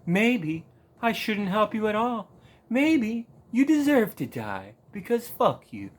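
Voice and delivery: gloating villain toying with a victim; hammed-up sinister taunt, drawn out; mock sinister. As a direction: savor refusing to help, with mock menace. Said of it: "The very sinister look on his face".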